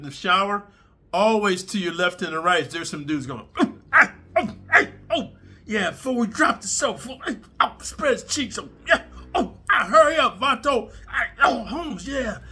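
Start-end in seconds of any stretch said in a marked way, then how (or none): 3.6-5.3 high, loud hammed-up moans; mock shower moans, high and loud; mock moaning. 5.7-12.4 high, laughing put-on prison voice; high, giggly imitation of shower inmates; mock inmate voice.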